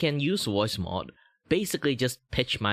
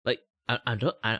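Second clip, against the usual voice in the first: normal talking voice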